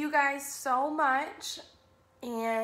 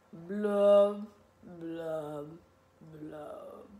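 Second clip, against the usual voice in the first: three drawn-out blubs stepping downward; glum fish blubs; 8 semitones lower, much slower than usual. Acted. Gloomy pout-pout fish ending the refrain, glum descending blubs.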